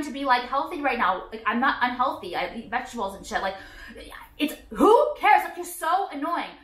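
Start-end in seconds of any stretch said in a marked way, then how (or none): 4.4-6.5 slow, loud, high who cares; exasperated. Real, not acted. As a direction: drag it out in disbelief. Exasperated rant at critics.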